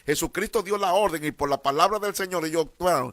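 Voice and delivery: loud, rising preacher's cry; sermon shout building up; fervent preaching. Real, not acted. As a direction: lift the room with it. Preaching at full force.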